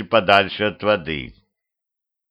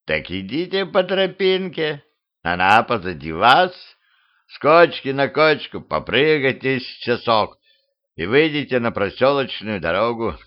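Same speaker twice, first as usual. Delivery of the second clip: skeleton. Acted. Skeleton directs travellers along the path, skeleton voice. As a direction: voice a talking skeleton giving leisurely directions.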